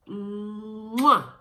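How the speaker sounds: loud smacking air kiss; loud mwah kiss; blown kiss. Acted. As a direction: blow a big kiss at the camera. Blowing kiss to friend.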